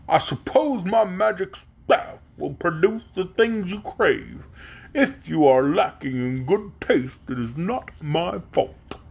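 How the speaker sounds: slow, drawn-out donkey king voice; stately, slow animal monarch; grudging donkey king. A narrator's reading. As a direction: concede it grudgingly, stretching every word.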